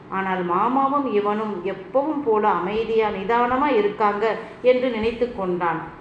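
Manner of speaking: ordinary delivery, nothing marked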